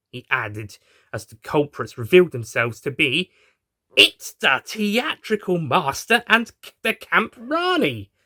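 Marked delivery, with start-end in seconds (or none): 4.0-8.1 high, slow, stunned cry of the names; high drawn-out shocked reveal; shocked shout. Acted. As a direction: gasp it out as a group in shock. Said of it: "Everybody shouted in unison and in shock".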